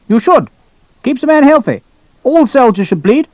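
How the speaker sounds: high, emphatic officer's pronouncement; brusque colonel. Acted. Colonel lecturing a captain on bleeding, brusque. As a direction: lay down the law in a high voice.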